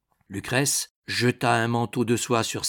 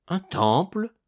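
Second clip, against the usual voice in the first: high, astonished question; 8 semitones higher than usual. A narrator's reading. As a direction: echo it back in surprise. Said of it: "s'écria César étonné". (question étonnée, voix haute)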